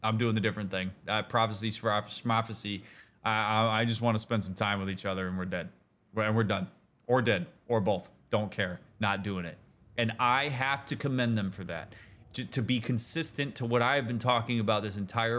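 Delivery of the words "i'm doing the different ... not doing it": lower, softer, unbothered shrug of a voice; laid-back dismissal. Retold or imitated. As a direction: shrug off the prophecy, lazy and calm. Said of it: "they're like".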